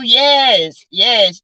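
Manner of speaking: normal talking voice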